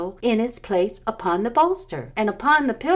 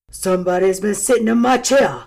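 louder gruff big bear; great rough gruff voice; 6 dB louder, faster than usual. A narrator's reading. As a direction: boom like the great huge bear. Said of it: "in his great rough, gruff voice".

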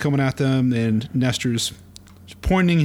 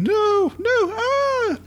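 high, panicked shrieking no; screaming victim; much slower than usual. Acted. Comic reader voicing a house victim, high panicked scream. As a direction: shriek it as the zombies close in. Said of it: "a person screaming out of a house".

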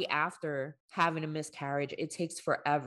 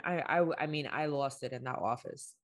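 ordinary talk, nothing marked

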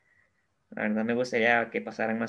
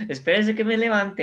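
laughing through the words; giggling joke, pitch up; 9 semitones higher, 5 dB louder than usual. Real, not acted. Laughing joke about his team.

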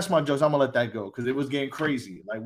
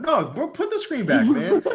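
laughing, pitched up, begging to keep going; laughing protest; 9 semitones higher than usual. Real, not acted. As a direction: laugh through it, pleading to go on.

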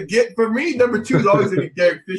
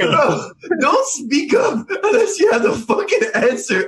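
laughing; 10 semitones higher, slower than usual. Real, not acted. Friends mocking Alex's wrong answer.

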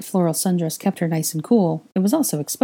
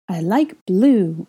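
put-on toddler voice; 3 semitones higher, 4 dB louder, much slower than usual. A narrator's reading.

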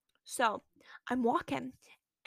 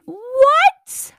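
high, loud, incredulous yelp; shocked outcry; 13 semitones higher, 13 dB louder than usual. Retold or imitated. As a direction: shriek it in mock disbelief, rising. Retold shocked reaction to an insult.

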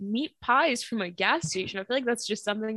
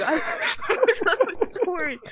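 laughing; 9 semitones higher, 4 dB louder than usual. Real, not acted. Relieved after long silence.

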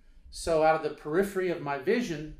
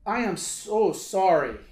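put-on loud, slow, higher Lebanese-accented apology; 5 semitones higher, 10 dB louder, slower than usual. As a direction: imitate a Lebanese man's earnest apology, each word drawn out. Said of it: "and he says to me".

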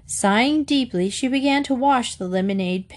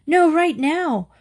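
put-on higher, slow, emphatic child's refusal; insistent; 8 semitones higher, 4 dB louder, slower than usual. A narrator's reading. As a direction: insist on having it immediately. Katy insists against waiting.

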